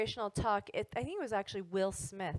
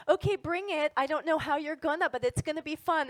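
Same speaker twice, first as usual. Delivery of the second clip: eager dare; 5 semitones higher, 5 dB louder than usual. Retold or imitated. Daring the universe to deliver.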